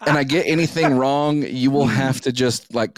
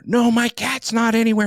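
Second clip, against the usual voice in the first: high, insistent imitation of her; angry girlfriend; 10 semitones higher than usual. Boyfriend imitating his angry date insisting the cat is gone, high and insistent. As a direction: snap it back, pitched up.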